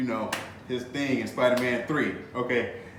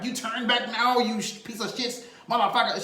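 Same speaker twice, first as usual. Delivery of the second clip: higher mock cussing outburst in Waller's voice; cussing Waller imitation; 7 semitones higher than usual. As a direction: ham up her swearing. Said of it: "she was just cussing".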